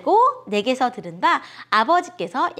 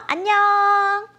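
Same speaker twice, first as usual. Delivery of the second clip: high, long-held sing-out farewell; drawn-out goodbye; 8 semitones higher, 4 dB louder, much slower than usual. Real, not acted. Host signs off to the children until next week, drawn-out goodbye. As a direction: call out a stretched, high goodbye.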